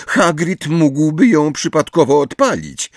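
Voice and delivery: high-pitched biting remark; scathing. A narrator's reading. Hermione explains to Umbridge, scathing. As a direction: lift the voice with scorn. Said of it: "odpowiedziała Hermiona zjadliwym tonem".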